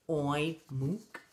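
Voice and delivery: low attempt that slips from "Oink" into "mook"; halting pig noise, "Oink, mook"; failed oink. A narrator's reading. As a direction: try "Oink", then muddle it into "mook". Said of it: "The pig is trying to say oink".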